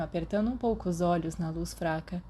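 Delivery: ordinary delivery, nothing marked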